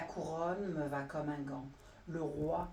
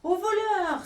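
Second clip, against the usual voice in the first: high, loud alarm cry; crying thief; 10 semitones higher, 8 dB louder than usual. Acted. Elephant cries out after the monkey, high and loud. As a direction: shout for help at the thief. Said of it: "s'écriait l'éléphant".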